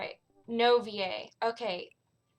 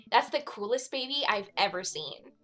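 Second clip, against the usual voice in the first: normal talking voice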